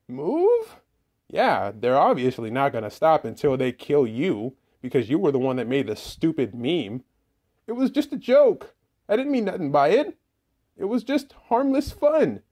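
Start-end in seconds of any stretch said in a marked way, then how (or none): 0.1-0.8 very high startled rise; a high cartoon kid, stunned; shocked squeak. Laffy Taffy shocked. 7.7-12.4 high, loud defensive squeak; a high cartoon kid, hurt and defensive; high squeaky character. Laffy Taffy defends joke.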